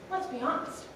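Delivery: ordinary delivery, nothing marked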